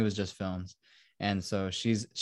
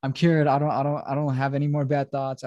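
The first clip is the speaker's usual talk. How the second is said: higher-pitched mock pleading; mildly raised, insistent mock protest; retold screaming; 5 semitones higher than usual. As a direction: imitate a freak-out at half strength. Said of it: "He starts screaming".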